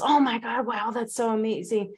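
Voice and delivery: mock an impressed follower; imitating a fan gushing over a transformation; gushing commenter. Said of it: "Everyone else".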